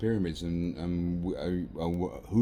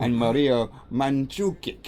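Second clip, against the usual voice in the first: slow Croatian accent, higher and louder; 5 semitones higher, 7 dB louder, slower than usual. Shown as a demonstration. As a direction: say the name like a Croatian. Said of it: "with a Croatian accent there".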